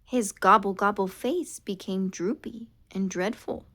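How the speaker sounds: ordinary delivery, nothing marked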